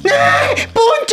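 high frightened cry of a name; startled scream. Acted. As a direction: shriek as if something grabbed you. Looks under bed, cries out.

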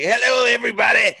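high, loud, fast super-excited greeting; bubbly Guy. Retold or imitated. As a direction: burst out with over-the-top bubbly excitement. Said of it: "He has got excitement in his voice".

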